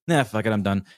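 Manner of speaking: usual speaking voice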